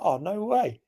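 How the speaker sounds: high, soft retold surprise; pleased surprise. Punter reacting to cabbie's news of a hometown lad, surprised. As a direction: act out a quiet, high-pitched disbelief. Hearing a local lad is around.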